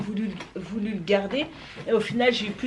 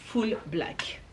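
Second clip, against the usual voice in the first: whisper; 5 dB quieter than usual. Real, not acted. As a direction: whisper the name like a secret. Répète le nom du modèle en secret. (nom chuchoté lentement, complice)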